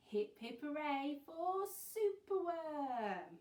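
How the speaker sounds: loud, high, drawn-out hooray; rousing cheer. A narrator's reading. Teacher reads the book's refrain to children, rousing cheer. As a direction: cheer it out, stretching the last words.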